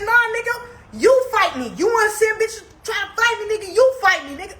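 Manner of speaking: high, heated dare to fight; angry challenge. Retold or imitated. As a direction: get in his face and dare him. Retold confrontation with Tim.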